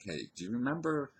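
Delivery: lifted, high-pitched tentative question; imagined approach. Pretend. Imagining going up to the old friend, questioning. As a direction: rehearse a high, questioning opener.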